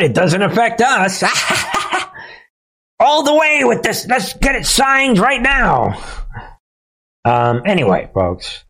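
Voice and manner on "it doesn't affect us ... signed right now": much higher, laughing mock voice; mock gleeful official. Voicing Saudi officials laughing off the pledge, high and gleeful. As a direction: gloat through a laugh.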